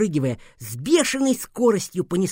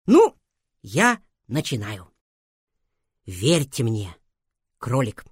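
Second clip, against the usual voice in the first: dramatic start; 6 semitones lower, slower than usual. A narrator's reading. Narrator opens the tale.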